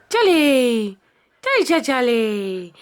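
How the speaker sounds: bright sing-song call across the room; calling the dog. Real, not acted. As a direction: call him in.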